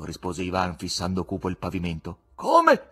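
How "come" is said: much higher, loud cry; a startled loud come; shouted what. A narrator's reading. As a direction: blurt it out forgetting caution. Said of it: "gridò l'ospite".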